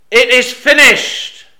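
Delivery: loud, slow, high proclamation; triumphant cry. Acted. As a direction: cry it out as the final victory. Jesus's cry quoted in sermon.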